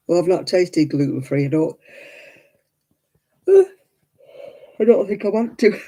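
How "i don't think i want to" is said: slow words through a laugh; laughing while talking. Real, not acted. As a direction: say it with a sigh and a laugh. Sighing about gluten-free food.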